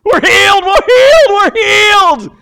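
very high, loud joyful cry; ecstatic shouting. Acted. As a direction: shout it to the world, overjoyed. Lepers rushing to priests.

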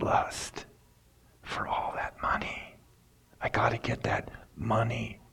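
whispered, lusting after money; greedy whisper. Acted. Preacher whispering Balaam's greed for the loot, hushed. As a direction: breathe it like a secret craving.

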